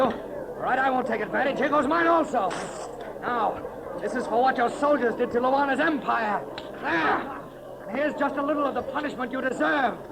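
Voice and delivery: high, strained voice mid-fight; defiant fighting talk. Acted. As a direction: talk while trading blows. Fighting while scolding the enemy.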